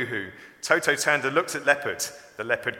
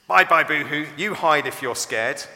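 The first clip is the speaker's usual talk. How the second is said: taunting; 4 semitones higher, 8 dB louder than usual. A narrator's reading. Toto taunts fleeing friend.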